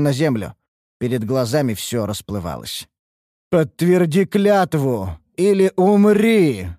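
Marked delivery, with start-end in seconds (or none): 3.5-6.7 slow, loud, otherworldly threat; eerie maddened voice. A narrator's reading. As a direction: roar it out like a possessed elf. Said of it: "жуткий голос обезумевшей лунной эльфийки".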